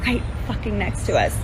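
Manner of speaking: hushed disbelief. Real, not acted. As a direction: voice dropping towards a whisper. Stunned recollection, near tears.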